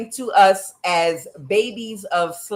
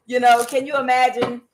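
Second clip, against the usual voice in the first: ordinary talk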